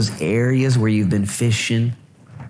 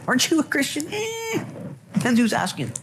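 mock evasive denial; 4 dB quieter than usual. Mock accuser and evasive reply.

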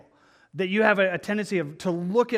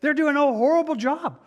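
imitating a furious husband, pitched much higher; a retold rant, high-pitched and indignant at the sloppy car wash; 6 semitones higher than usual. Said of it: "the husband was just furious".

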